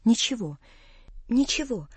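hushed, holding back sobs; suppressed tears. A narrator's reading. Daughter reassuring father through held-back tears, softly. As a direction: choke the tears down and insist softly. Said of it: "задыхаясь от внутренних подавленных слёз".

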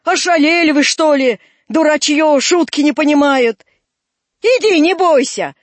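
put-on loud, exasperated old man; gruff scolding. Old man scolds boys who misread his joke, gruff scolding. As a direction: scold them loudly, then urge them in.

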